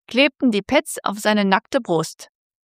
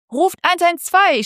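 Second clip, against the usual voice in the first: high, loud desperate yell; a sudden scream for help; 8 semitones higher, 4 dB louder than usual. Acted. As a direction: shout the emergency number out. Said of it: "schrie sie".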